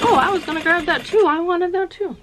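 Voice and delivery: high, loud mock whiny shopper; imitating a shopper. Shopper to the speaker, a high and loud mock whine. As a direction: play the other shopper, whining that she wanted it too. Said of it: "they'd probably be like".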